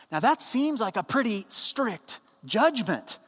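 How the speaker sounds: ordinary delivery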